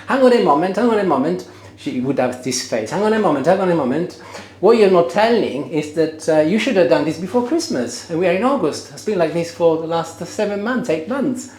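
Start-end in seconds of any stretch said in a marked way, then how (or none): 0.0-1.5 higher, louder indignant wife interrupting; Julie's persona. Retold or imitated. Husband voicing his absent wife's objection, higher and louder. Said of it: "let me get into Julie's persona". 2.9-11.5 higher and louder, a wife's indignant rebuttal; Julie's persona. Retold or imitated. Husband voicing his wife blaming him for the delay, higher, louder. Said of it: "let me get into Julie's persona".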